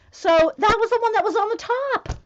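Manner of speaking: high, loud, emphatic marvelling; thrilled disbelief, loud and high; amazed excitement. Real, not acted. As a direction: stress the lucky coincidence, voice way up. Amazed at the coincidence.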